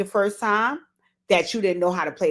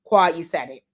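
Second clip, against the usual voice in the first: ordinary talk, nothing marked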